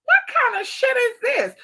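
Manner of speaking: high, drawn-out incredulous quote; shocked disbelief. Retold or imitated. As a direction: gasp at the insult, way up high. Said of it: "And I'm just like".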